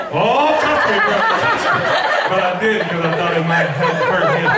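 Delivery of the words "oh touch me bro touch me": high-pitched, hammed-up begging to be touched; mock eager plea. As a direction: plead in a silly high voice. Acts out his eager near-reaction.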